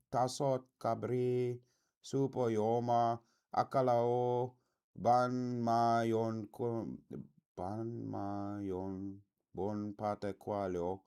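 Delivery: slow, halting read of unfamiliar foreign words; tentative, drawn-out word-by-word reading; slow sounding-out. Real, not acted. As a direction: sound out each strange word slowly, stumbling and retrying.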